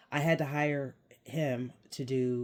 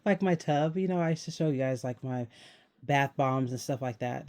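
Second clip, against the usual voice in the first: normal talking voice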